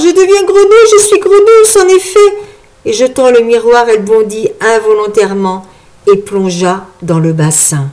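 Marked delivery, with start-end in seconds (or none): 0.1-2.4 high, loud wail of despair; despairing cry. A narrator's reading. Marguerite realising she is becoming a frog, despairing. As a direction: lament in horror, voice raised high. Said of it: "s'écria-t-elle avec désespoir".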